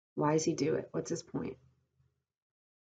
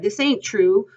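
high, loud denial; upset protest at raised pitch; crying out; 7 semitones higher, 10 dB louder than usual. A narrator's reading. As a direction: cry it out insistently. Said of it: "he cried".

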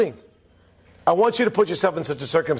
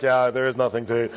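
a lazy, lower murmur of agreement; mock men agreeing; 7 semitones lower than usual. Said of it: "The men are like".

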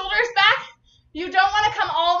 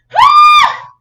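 loud high strained shout; effort yell; 13 dB louder than usual. Real, not acted. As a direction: belt out a sudden effort cry. Effort during burpees.

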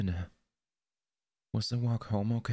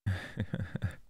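quiet amused chuckle; soft laugh; 4 dB quieter than usual. Acted. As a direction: possessive partner's soft chuckle. Possessive partner teasing.

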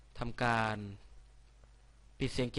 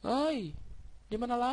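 real high startled cry, then a high puzzled question; shocked yelp; 13 semitones higher than usual. Real, not acted. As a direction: jolt up in real shock and ask what's going on. Sudden fall into new place.

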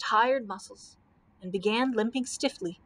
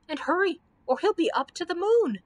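high, anxious urging; urgent cry; 7 semitones higher than usual. A narrator's reading. Dorothy fears knight will rise moonward, urgent cry. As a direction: press them to hurry, pitch climbing. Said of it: "cried Dorothy".

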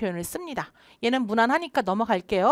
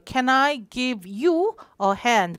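slow model read; much slower than usual. Shown as a demonstration. Teacher repeats model slowly.

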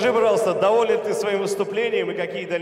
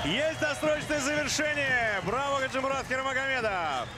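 excited shout; 5 semitones higher, 3 dB quieter than usual. Real, not acted. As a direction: call the stoppage with a yell. Финиш боя, комментатор кричит. (восторженный крик комментатора)

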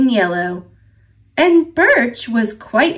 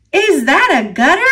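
fast, higher, louder call; shout; 6 semitones higher, 4 dB louder, faster than usual. A narrator's reading. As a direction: shout it over the wind. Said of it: "Maple shouted".

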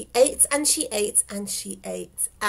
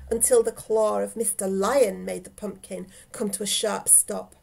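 ordinary delivery, nothing marked

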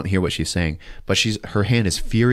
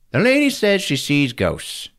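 slow, high put-on voice; mocking, drawn-out high recital; mock report-writing; 7 semitones higher than usual. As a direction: spell it out slowly as if writing. Voicing the report as he writes.

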